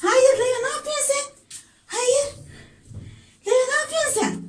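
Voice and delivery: high, startled cry of no; alarmed scolding. Real, not acted. Startled when Leo the cat attacks her, high alarmed scolding. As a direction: yelp at the cat that just clawed you.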